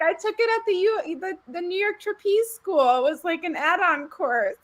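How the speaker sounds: high, breezy acted boast; mock proud spy. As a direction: brag lightly about the course. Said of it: "And it's like".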